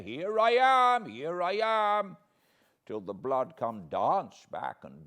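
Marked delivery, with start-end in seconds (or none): 0.0-2.2 high, loud bellow, full of glee; bellowing shout. Retold or imitated. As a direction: boom it right into his ear. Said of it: "shout and bellow in my ear".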